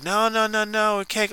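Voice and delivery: high whiny mock protest; mocking Lana. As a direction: squeal it like a spoiled refusal. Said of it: "she was like".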